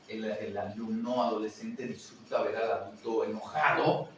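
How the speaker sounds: normal talking voice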